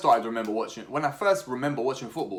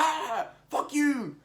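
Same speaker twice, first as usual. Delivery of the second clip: much higher, slow mock outburst; much slower than usual. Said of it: "And they're like".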